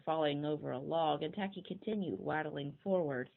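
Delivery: ordinary delivery, nothing marked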